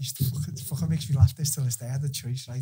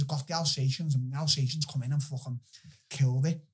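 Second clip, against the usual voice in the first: normal talking voice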